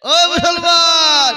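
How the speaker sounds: loud, high, long-drawn call. Real, not acted. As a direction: holler it out slowly to a whole crowd. Calling out to the congregation.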